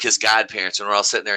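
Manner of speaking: ordinary talk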